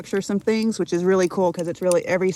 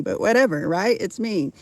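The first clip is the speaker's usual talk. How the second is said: normal talking voice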